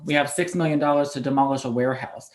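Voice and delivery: usual speaking voice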